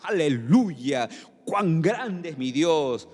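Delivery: alabanza alargada y lenta, sin subir el volumen; grito de alabanza. Acted. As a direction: exclama despacio, estirando las palabras. (grito de júbilo contenido)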